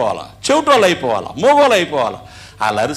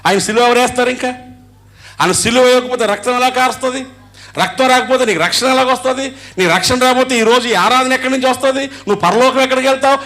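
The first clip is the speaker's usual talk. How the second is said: preaching at full force; 7 semitones higher than usual. Acted. Rising sermon climax.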